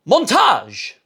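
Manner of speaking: high sing-out exclamation; montage announcement. Acted. Vlogger announcing the cutaway to viewers, high excited call. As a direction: call it out high, with a burst of energy.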